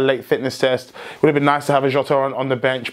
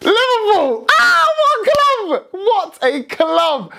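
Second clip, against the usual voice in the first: much higher, elated cries to the fans; excited shouting; much slower than usual. Real, not acted. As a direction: burst out celebrating the club. Celebrating his club's win.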